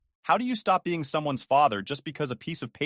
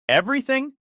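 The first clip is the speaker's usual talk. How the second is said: incredulous; 13 semitones higher, 5 dB louder than usual. A narrator's reading. Incredulous echo in argument.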